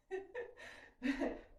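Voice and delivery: light, breathy laugh to herself; amused chuckle. Real, not acted. Instructor amused at her own golf comparison, light laugh.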